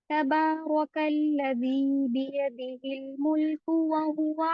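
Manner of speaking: slow chanted tilawat, every vowel drawn out; Quran recitation. Shown as a demonstration. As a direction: recite slowly and melodiously, giving each word time. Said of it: "इतना time देना है, ऐसे ही पढ़ना है".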